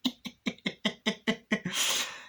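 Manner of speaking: breathy high cackle; Chucky's cackle. Retold or imitated. Chucky laughing at Glenn, a breathy cackle. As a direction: cackle mockingly. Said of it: "Chucky's like".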